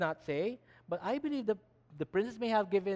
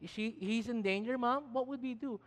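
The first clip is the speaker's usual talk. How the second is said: put-on higher-pitched voice of a worried girl; worried child; 4 semitones higher than usual. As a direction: plead to Mom as an anxious little sister. Voicing a worried child asking Mom.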